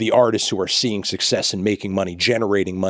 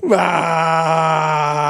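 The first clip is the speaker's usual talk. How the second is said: frustrated cry; 7 semitones higher than usual. Acted. Frustrated outcry in ad.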